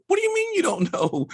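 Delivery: very high, disbelieving squeal of a question; can't believe what she just said; high incredulous demand; incredulous outburst. Retold or imitated. Disbelief at wife's answer.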